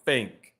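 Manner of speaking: "Think" said as "fink", teeth on lip, no tongue; rabbit face th demo. Shown as a demonstration. Teacher demonstrating the teeth-on-lip trick on "Think". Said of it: "You don't need to use your tongue".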